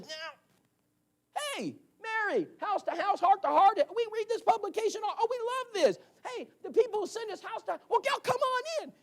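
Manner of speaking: high, syrupy fake welcome; mock-sweet host. Husband pretending to love visitors, high sweet fake voice. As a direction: gush over them in a high sugary voice.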